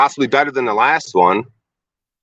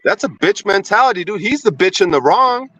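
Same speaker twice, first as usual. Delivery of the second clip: angry; 8 semitones higher than usual. Real, not acted. Angry retort at Rob.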